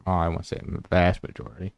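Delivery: ordinary talk, nothing marked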